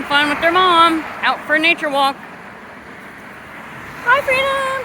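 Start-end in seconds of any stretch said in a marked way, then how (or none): 4.0-4.8 high, sing-song pet talk. Real, not acted. Owner greets her parrot.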